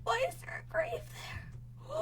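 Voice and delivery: soft high whisper; whisper, unnerved; spooked whisper. Real, not acted. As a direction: hush it, creeped out. Spooked by grave in game.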